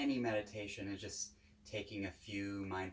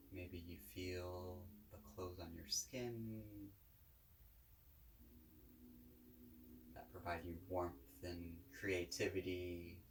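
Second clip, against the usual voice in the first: calm, unhurried guided-meditation voice; 3 dB quieter than usual. Real, not acted. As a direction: guide listeners gently, with long pauses between phrases.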